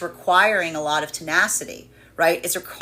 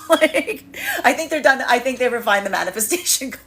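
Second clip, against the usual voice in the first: laughing; 6 semitones higher, 4 dB louder than usual. Real, not acted. Laughing mock about the universe.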